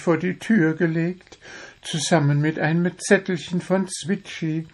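high, self-satisfied bird voice; confident little bird. A narrator's reading. Little bird confesses his rose gift for Gundula, confident. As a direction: boast confidently in a high pitch. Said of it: "Zwitschi nickte voller Zuversicht".

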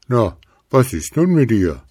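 a cheeky poke at the owl; snowman teasing. A narrator's reading. Snowman needles the sitting owl, teasing. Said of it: "fragte die Stimme von vorhin".

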